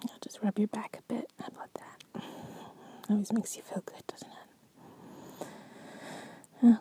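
soft ASMR whisper. Acted. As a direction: breathe it to your partner.

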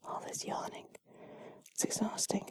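very soft, breath-only voice; hushed and drowsy; sleepy murmur. Pretend. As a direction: whisper it as if worn out by yawning. Sleepy after all the yawning.

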